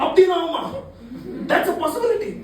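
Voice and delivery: high, loud mock amazement; hammed-up wonder; astonished realization. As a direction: exclaim as if it just dawned. Acted reaction of astonished guy.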